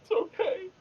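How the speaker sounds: high, wailing fake sob; mock crying. Consoling himself after losing roll.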